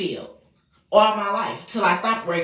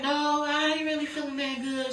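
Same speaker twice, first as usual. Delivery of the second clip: weak grandma; 8 semitones higher, 3 dB quieter than usual. Retold or imitated. Dying grandma answers weakly.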